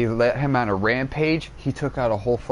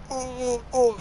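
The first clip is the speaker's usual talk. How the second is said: high strained groan, softer; a stabbed man's last pained grunt; dying groan; 14 semitones higher, 3 dB quieter than usual. Retold or imitated. Dude impaled by Doomsday, high strangled dying groan.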